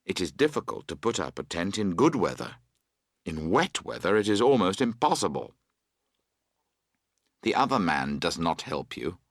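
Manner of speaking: ordinary delivery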